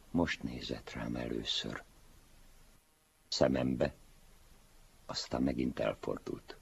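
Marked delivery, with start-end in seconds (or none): none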